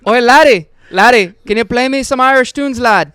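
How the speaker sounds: loud, high stage-Irish brogue; a bellowed, overdone Irish brogue; hammed-up Irish accent. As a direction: ham up the Irish lad voice, loud. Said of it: "Okay, that was better".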